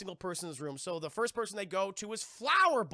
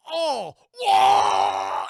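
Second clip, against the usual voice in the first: high, very loud yell; hammed-up shout of shock; mock agonized cry; 8 semitones higher, 15 dB louder than usual. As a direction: yell out as the needle hits. Ron reacts to the injection.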